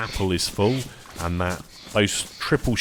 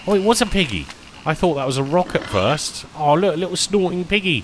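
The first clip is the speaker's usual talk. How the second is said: higher, louder delight at the piggy; excited; 10 semitones higher, 6 dB louder than usual. Real, not acted. Streamer spotting a piggy in the game, higher louder excitement. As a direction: point it out with a burst of delight.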